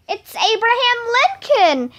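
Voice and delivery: high-pitched, starstruck exclamation; excited shout. A narrator's reading. Emily spotting Abraham Lincoln in class, excited shout. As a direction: call it out high, with only a little extra volume. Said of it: "shouted Emily".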